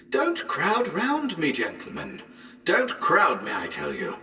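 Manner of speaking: Don't crowd me, high then sinking; panicked. Acted. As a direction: back away from them in panic. Panic among crowding men.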